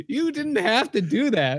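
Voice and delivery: put-on protesting kid. Kids protesting to angry man.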